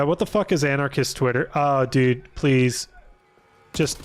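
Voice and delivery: normal talking voice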